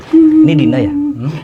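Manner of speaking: put-on high, whimpering little girl spirit; ghost child voice. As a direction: answer as a scared dead child. Medium channels child spirit Dina.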